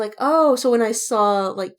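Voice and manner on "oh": sudden loud, high oh; surprised oh. Retold or imitated. Friend retelling seeing fan art, loud surprised oh. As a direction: blurt it out in surprise. Quoting own reaction, loud.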